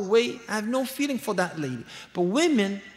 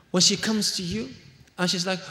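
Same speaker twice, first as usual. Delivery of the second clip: ordinary delivery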